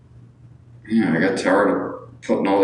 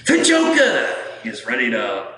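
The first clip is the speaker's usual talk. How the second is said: higher-pitched villain voice; botched villain impression, pitched up; Joker; 5 semitones higher than usual. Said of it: "oh, I have to do it right".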